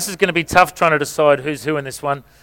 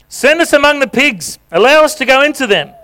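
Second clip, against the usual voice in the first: begging demons; 8 semitones higher than usual. Acted. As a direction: plead like desperate spirits, much higher.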